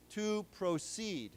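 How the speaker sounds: slow "To proceed", stress on CEED; drawn out, pro-CEED; verb stress. Shown as a demonstration. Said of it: "the 动词".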